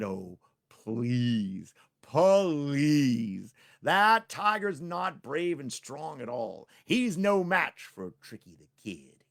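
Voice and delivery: Tricky scoffing at the tiger, slow and loud; scoffing brag. Acted. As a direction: wave the tiger off with scorn.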